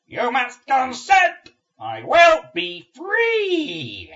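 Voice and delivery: slow, loud, high buzzing command; buzzing demon voice. Acted. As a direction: drone the order like a swarm queen. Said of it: "The buzzing voice".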